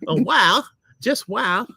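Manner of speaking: a high second try at a character; put-on character voice. Shown as a demonstration. Husband trying a second voice for the review, character voice. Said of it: "you have to be a lady".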